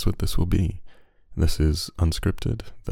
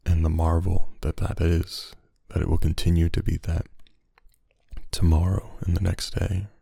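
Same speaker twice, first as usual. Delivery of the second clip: unhurried, hushed reflection, letting each phrase settle; ASMR monologue. Acted. Podcast host easing listeners into rest and sleep, soothing ASMR monologue.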